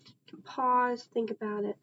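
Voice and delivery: ordinary talk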